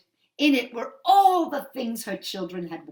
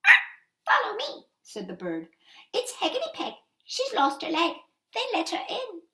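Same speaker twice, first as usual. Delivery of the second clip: very high chirpy bird voice with a laugh; little blackbird; 10 semitones higher, 3 dB louder than usual. Acted. Blackbird leading the mother, in a high chirpy voice.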